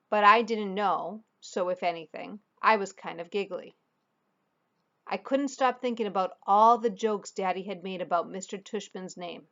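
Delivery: usual speaking voice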